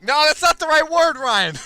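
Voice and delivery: loud, high outburst; exasperated self-scolding. Real, not acted. Player correcting his own misread word, loud high exasperation. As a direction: shout at yourself for the mistake.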